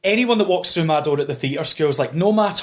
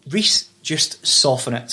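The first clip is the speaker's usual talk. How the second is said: slowed right down; slow advice; 4 semitones lower, much slower than usual. Retold or imitated. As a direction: say it slowly and gently. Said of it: "they're gonna go".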